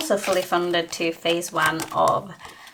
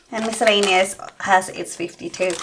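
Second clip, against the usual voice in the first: ordinary talk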